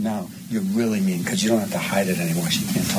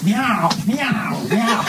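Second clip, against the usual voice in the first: mock meow; 13 semitones higher, 5 dB louder, much slower than usual. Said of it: "can you now meow".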